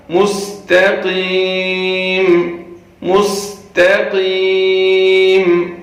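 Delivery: slow مستقیم with a light thin ta. Shown as a demonstration. Teacher modelling مستقیم. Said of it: "تو یہاں پہ خیال کریں گے آپ".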